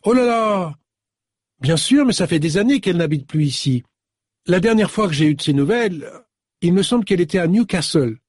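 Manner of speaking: put-on young woman's voice. Young woman on the phone recalls Celia, lightly voiced young woman. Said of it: "Une voix jeune et féminine".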